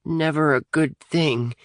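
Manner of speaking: slow, high nervous remark; a worried kid muttering his opinion. A narrator's reading. Atticus uneasy about the self-closing door, nervous and slow. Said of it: "opined Atticus nervously".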